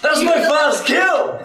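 high, slow triumphant yell; excited shout. Real, not acted. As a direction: crow it out with glee. Player's first kill, celebrating.

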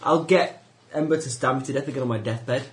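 normal talking voice

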